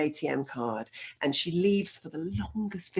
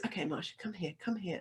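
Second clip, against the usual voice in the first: quiet, calm and controlled; 10 dB quieter than usual. Retold or imitated. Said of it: "in that same very calm voice".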